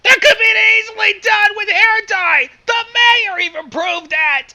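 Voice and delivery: high, loud indignant yelling; outraged shout. Acted. Friend yelling at Twilight over needless machines, outraged shout. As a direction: yell it in exasperated disbelief. Outraged retort about hair dye.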